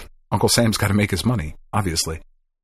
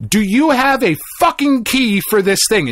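exasperated demand; 10 semitones higher than usual. Retold or imitated. Demanding a key from staff.

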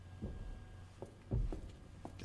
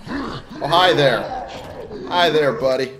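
higher, louder, sing-song fake cheer; mock friendly greeting; 4 semitones higher, 6 dB louder than usual. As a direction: greet the monster like an old pal. Greeting the stalker mockingly.

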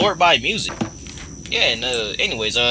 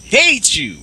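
angry yelling; 9 semitones higher than usual. Real, not acted. Yelling at monster after scare.

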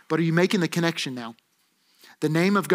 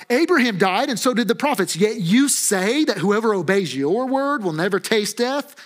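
acted incredulous crowd, pitched up; raised, emphatic mock indignation; indignant challenge; 4 semitones higher than usual. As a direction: challenge him in disbelief, building to the last question.

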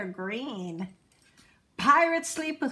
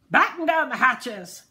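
loud storm command; shouted; 2 semitones higher, 7 dB louder than usual. A narrator's reading. Braidbeard ordering the crew in the storm, loud shout. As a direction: bark it over the wind. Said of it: "Braidbeard shouted".

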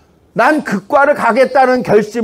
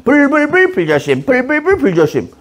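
higher-pitched chant of 불불불 불조심; higher, repeated sign-reading with 불불불 run together; repetitive reading; 5 semitones higher than usual. Retold or imitated. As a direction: read 불불불 불조심 over and over in a raised singsong. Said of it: "계속 읽으면서 지나갔어요".

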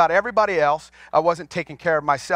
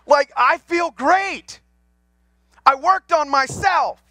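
joyful lift; 9 semitones higher, slower than usual. Real, not acted. Joyful testimony of healing.

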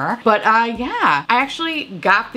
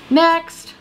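calling out next; 10 semitones higher than usual. Real, not acted. Vlogger jumps to the next product, shouting high. Moving to next bingo item.

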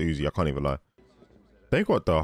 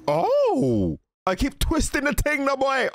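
high-pitched, agitated reaction; alarmed outcry; slower than usual. Real, not acted. Viewer watching someone keep twisting the thing, alarmed. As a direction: cry out high in disbelief.

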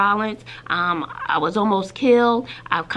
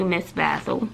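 usual speaking voice

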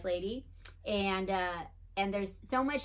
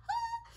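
high squeal of being tickled, softer; 5 dB quieter than usual. Retold or imitated. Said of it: "I'd be like".

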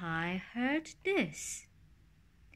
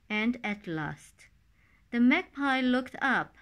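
ordinary delivery, nothing marked

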